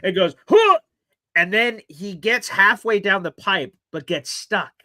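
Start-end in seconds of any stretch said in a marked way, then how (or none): 0.5-0.8 loud, high mock grunt with a salute; mock battle grunt. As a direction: bark out a heroic grunt. Salute shout in retold scene.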